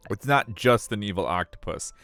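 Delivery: ordinary delivery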